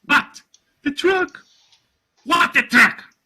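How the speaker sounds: very high, loud disbelief; alarmed outcry. Acted. Father hearing bad news about the truck, alarmed shout.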